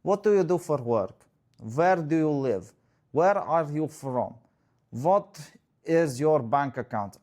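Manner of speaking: put-on dull date guy in a Russian accent; mock Russian accent, deadpan. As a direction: bore her with a Russian accent. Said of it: "this guy's Russian, but he is".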